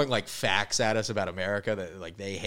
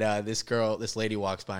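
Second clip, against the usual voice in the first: usual speaking voice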